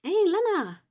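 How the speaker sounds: high, loud eager hello; excited greeting. Real, not acted. Painter greets Lena joining the chat, excited. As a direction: call out happily to a friend arriving.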